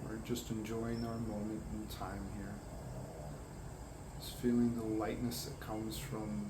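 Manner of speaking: calm, unhurried guidance with long pauses; guided meditation. Acted. Guiding listeners to imagine grounding light, calm meditation voice. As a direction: lead them gently inward, drawing out key words.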